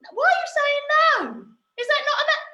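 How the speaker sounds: high-pitched baffled protest; mock incredulous. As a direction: play shocked that they disagree. Mock protest at children's answer.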